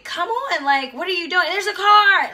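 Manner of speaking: high, pressing "come on, there's a car"; urgent mom. Retold or imitated. A mother urging her daughter across the road, urgent. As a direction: hurry a frozen child along.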